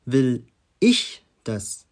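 slow word by word, punching "ich"; stress on ich. Shown as a demonstration. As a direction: set "ich" apart with pauses and hit it hard. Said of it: "mit drei unterschiedlichen Betonungen".

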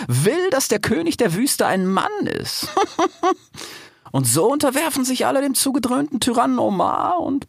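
high, theatrical hyena with cackles; hyena Aisha. A narrator's reading. Hyena vents about the tyrant and flatters the fox, high sly voice.

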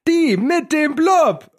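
high, slow showman announcement; TV show announcer. Pretend. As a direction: announce it like a game show host. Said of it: "Fernsehschau, Buchstaben eingeleuchtet".

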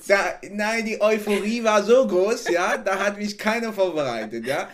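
excited storytelling. Real, not acted. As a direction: pour it out with excitement. Excited retelling of arrival.

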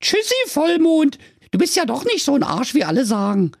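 put-on high, cheeky Berlin girl voice; little girl Ute. Ute hands the moon rolls, high cheeky girl voice. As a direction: play the sassy little girl, pitched high.

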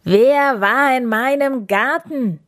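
bear roar. A narrator's reading. Bear demands who was in his garden, roaring. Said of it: "holte tief Luft und brüllte".

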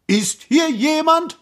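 high-pitched searching call. A narrator's reading. Prince calling into the empty gorge, high call. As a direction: call out for any answer. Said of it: "rief der Prinz".

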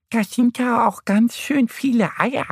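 high-pitched cartoon duck voice; amused little duck. A narrator's reading. Annie the duck to her friend Mo, amused. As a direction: pipe up high as a merry duck. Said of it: "lacht Annie".

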